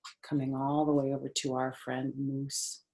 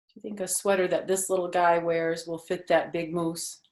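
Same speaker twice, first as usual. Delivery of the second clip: usual speaking voice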